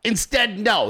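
normal talking voice

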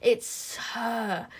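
drawn-out hiss of the witches. A narrator's reading. Witches recognising the girl with magic, hissing. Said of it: "hissed the witches".

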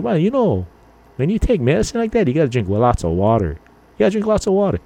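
high lecturing friend voice; friend's advice. Retold or imitated. Friend telling Ron to drink water, high and preachy. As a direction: lecture him like a know-it-all buddy.

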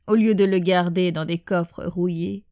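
measured, hushed poem reading with long pauses; poem recitation. Acted. Sharing a poem in homage to departed loved ones, measured recitation. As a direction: recite it line by line, letting each phrase settle.

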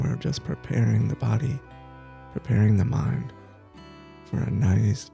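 ordinary delivery

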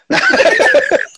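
high laugh bursting out; burst of laughter. Real, not acted. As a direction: laugh it off hard. Joking about throwing a brick.

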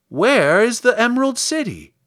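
high, loud scarecrow question; curious scarecrow. Acted. Scarecrow asks the girl her destination, curious. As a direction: pipe up inquisitively.

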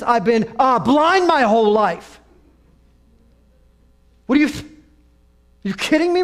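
loud, high, incredulous retelling; exasperated blind man. Acted.

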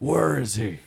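loud growling superhero voice; put-on throaty vigilante growl; Batman. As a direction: growl it out loudly, as a competing impression. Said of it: "there's a good Batman voice".

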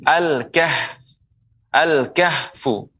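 slow, careful "al-kahfu"; slow model reading. Shown as a demonstration. Teacher modelling "al-kahfu" slowly to viewers. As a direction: sound out "al-kahfu" for students. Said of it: "huruf kaf".

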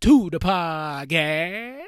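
PODCAST drawn out as "pah-caaa", end consonants dropped; sing-out "pahhh-caaast" with a swallowed ending; playful mispronunciation. Shown as a demonstration. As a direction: stretch podcast long and rising, no final st. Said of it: "like I said, that podcast".

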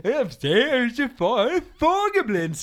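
put-on high, loud jokey old man voice; Gunnar joking. Gunnar excusing himself for the wrong car, high and loud. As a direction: toss off the punchline like Gunnar. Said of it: "sier han da".

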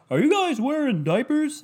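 high, off-key host voice, clearly not him; bad Steve Harvey impression. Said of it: "It doesn't sound like Steve Harvey".

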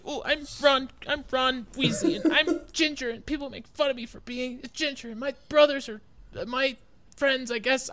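high, annoying mock-kid voice; same whiny put-on child voice; Ron Weasley. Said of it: "All the kids sound the same".

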